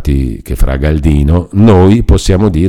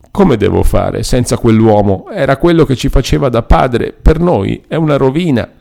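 higher-pitched plaintive lament; mildly tearful, helpless plea; nearly crying; 6 semitones higher than usual. A narrator's reading. Agnese laments losing the fatherly friar, nearly crying. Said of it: "quasi piangendo".

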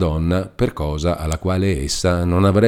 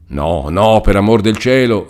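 louder anxious plea; repeating anxiously; 4 semitones higher, 7 dB louder than usual. A narrator's reading. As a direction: echo the plea urgently. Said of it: "ripeteva Agnese".

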